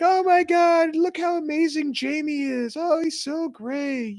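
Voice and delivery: gushing adults. Retold or imitated. Relatives gushing over Jamie swimming, in high mock praise. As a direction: ham up the adults fawning over the cousin. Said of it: "They're like".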